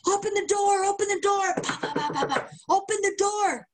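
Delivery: high, urgent calls to open the door; mock girlfriend shouting through the door; girlfriend yelling at door. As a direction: pound on the door, demanding. Girlfriend banging on bathroom door.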